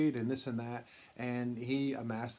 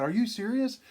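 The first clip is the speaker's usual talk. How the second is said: high, loud disbelief; surprised; 9 semitones higher, 6 dB louder than usual. Retold or imitated. Drew hearing the lost cards turned up, loud surprised disbelief. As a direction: shoot the question up in amazement.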